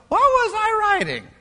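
high-pitched incredulous question; appalled self-questioning. Acted. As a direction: voice jumps up, baffled at your own words. Solomon looking back, appalled.

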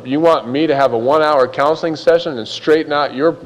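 ordinary talk, nothing marked

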